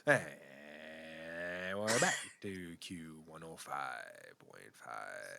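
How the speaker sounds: low, slow smooth DJ announcer; radio DJ voice. Shown as a demonstration. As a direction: purr it out like a late-night DJ. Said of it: "Do they do the radio voice?".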